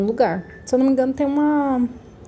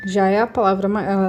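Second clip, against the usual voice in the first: ordinary delivery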